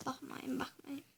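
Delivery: hushed, pleading whisper. Real, not acted. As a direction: whisper it, don't look. Hiding, whispering not to look.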